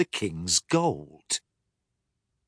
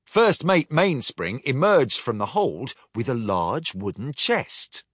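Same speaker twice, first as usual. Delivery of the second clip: ordinary delivery, nothing marked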